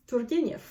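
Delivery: Turgenev with a rolled R. Shown as a demonstration. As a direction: roll the R in Turgenev. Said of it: "I can roll an R".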